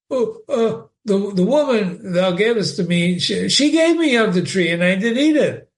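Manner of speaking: high, fumbling frightened man's voice; stammering Adam. Acted. Adam blaming the woman to God, high nervous stammer.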